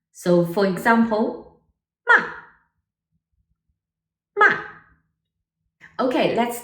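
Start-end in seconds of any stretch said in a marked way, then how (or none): 2.1-2.2 sharp falling "ma"; short, steep drop on "ma"; fourth tone. Shown as a demonstration. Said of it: "it's sharp and falling". 4.4-4.6 "ma" falling sharply; brief "ma" with a steep fall; fourth tone. Shown as a demonstration. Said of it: "it's sharp and falling".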